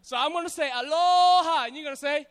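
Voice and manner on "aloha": "aloha" called loud, long on LO; big drawn-out aloha. Shown as a demonstration. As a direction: stretch and shout the middle of aloha. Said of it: "respond with the same energy".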